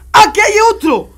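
loud, high exclamation; real surprise. Real, not acted. Streamer reacting to another one there, surprised shout. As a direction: blurt it out, loud and startled.

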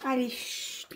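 soft unvoiced lullaby whisper; whispering over a crib. Real, not acted.

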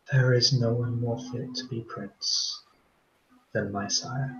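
very soft, hushed and confiding; soft whisper in the ear. Acted. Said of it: "whispers in your ear very softly".

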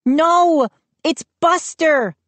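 put-on high, excited girl's cry; Mindy crying out. A narrator's reading. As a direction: call out eagerly as Mindy. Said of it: "Mindy cried".

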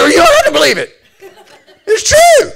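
high, loud holler; retold shouted comeback. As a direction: yell it back across the table.

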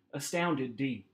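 ordinary delivery

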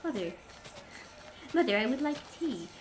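lightly hammed-up posh British accent. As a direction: put on a mock posh accent to ask mother. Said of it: "I speak quite posh".

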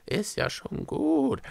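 high, drawn-out abashed placating; sheepish. A narrator's reading. Izanuela placates shouting Echo, abashed. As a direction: back down slowly and sheepishly. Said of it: "sagte Izanuela betreten".

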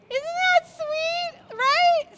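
high, loud, slow cooing. Real, not acted. Cooing over baby rolling.

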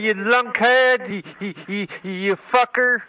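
sputtering, stuttered insults; stuttering. Acted. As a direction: get stuck on "you" before the insult. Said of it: "You can't talk without stuttering".

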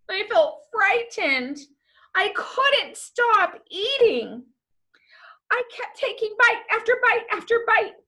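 high-pitched, anxious narration; mounting panic. A narrator's reading. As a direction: tell it as a frightened child, rising into distress. Narrator acts a child's growing panic.

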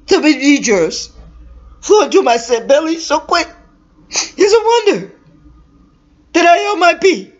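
high, trembling fearful wail; keening. Acted. Woman telling her sister her fear, keening high. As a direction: wail it high, half sobbing with fright. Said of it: "She keened most pitifully".

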